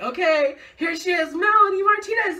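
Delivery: high, loud build to the singer's name; announcer hype. Retold or imitated. Event host introducing [name], loud rising hype. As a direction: call the name like a stage host.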